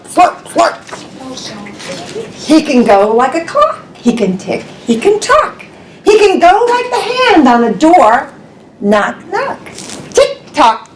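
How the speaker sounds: ordinary delivery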